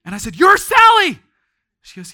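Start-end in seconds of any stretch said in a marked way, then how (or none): 0.3-1.2 loud shout of recognition; excited shout. Retold or imitated. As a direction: exclaim it in joyful shock. Preacher tells the woman she's Sally.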